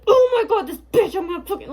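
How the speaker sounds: high, loud, hammed-up raging outburst; mock fit of rage. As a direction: imitate someone flying into a rage, half laughing. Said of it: "she acts in a fit of rage".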